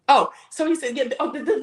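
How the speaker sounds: ordinary talk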